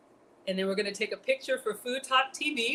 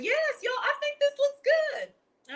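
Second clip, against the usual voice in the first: much higher, slow, squeaky; slower than usual. Pretend. Sudden squeaky high voice.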